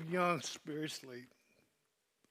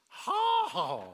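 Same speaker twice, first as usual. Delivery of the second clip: sharp laugh; 6 dB louder than usual. Real, not acted. Laughing at his own joke.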